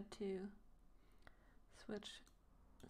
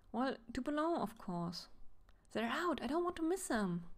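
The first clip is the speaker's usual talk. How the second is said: high, eager, pushy woman; impatient passenger; 5 semitones higher than usual. Acted. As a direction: insist like it's obvious.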